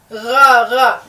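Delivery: غ said deep in the throat as a rasping gh; throaty gh sound. Shown as a demonstration. Teacher shows students the throat sound, rasping gh. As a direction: scrape غ from the back of the throat. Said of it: "دیکھیے حلق سے آواز نکل رہی ہے".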